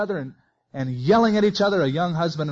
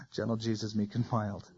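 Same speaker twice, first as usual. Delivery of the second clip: mock meek; 7 semitones lower, 9 dB quieter than usual. Mocking meek Jesus picture.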